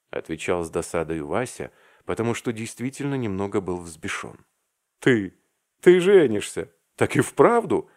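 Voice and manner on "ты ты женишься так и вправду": louder, higher cry of disbelief; shocked shout. A narrator's reading. Arkasha yells at Vasya's news of marriage, astonished shout. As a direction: shout it out in sheer amazement. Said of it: "закричал благим матом Аркаша".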